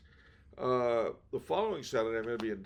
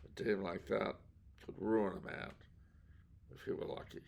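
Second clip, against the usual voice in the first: noir detective; 3 semitones lower, 7 dB quieter than usual. Pretend. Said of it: "Is that hard-boiled enough for you?".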